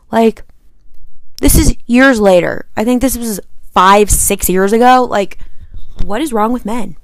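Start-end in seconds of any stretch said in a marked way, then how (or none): none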